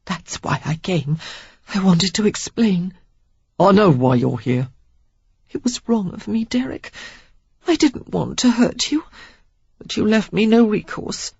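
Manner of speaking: high, pained pleading; pleading woman. A narrator's reading. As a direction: plead through the pain. Joyce pleading in pain.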